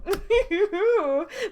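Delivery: high, loud giggling squeal; giddy high laugh. Real, not acted. Player squealing with giggles after a kiss. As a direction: giggle like a fangirl.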